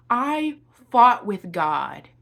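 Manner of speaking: usual speaking voice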